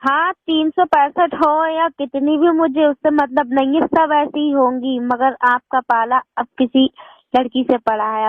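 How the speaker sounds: ordinary talk, nothing marked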